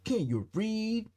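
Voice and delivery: hammed-up angry neighbour yelling at outsiders; imitating an angry resident. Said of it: "in the voice that I imagine how, how she sounds".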